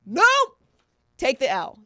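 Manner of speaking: loud, high shut-down; emphatic rejection. Real, not acted. Host dismissing the imagined belief, emphatic and loud. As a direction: cut it off with a loud, high refusal.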